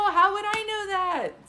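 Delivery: high, loud astonished question; amazed disbelief. Real, not acted. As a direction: marvel at how you could know this. Amazed at her own hit.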